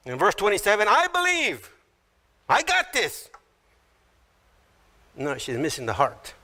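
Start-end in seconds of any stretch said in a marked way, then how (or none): 0.9-3.3 high-pitched mock self-assurance; overconfident insistence. Martha answering Jesus, high overconfident insistence. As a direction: brush help aside, pitched high.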